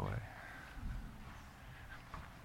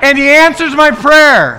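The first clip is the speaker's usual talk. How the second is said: high, loud declaration for the church to echo; call-and-response leader, much higher and louder; preaching at full force; 12 semitones higher, 11 dB louder than usual. Real, not acted. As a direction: proclaim it boldly. Leads declaration.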